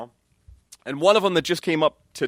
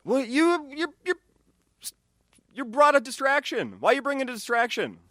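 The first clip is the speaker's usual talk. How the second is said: put-on incredulous old-timer voice; 12 semitones higher than usual. Retold thought about phone users.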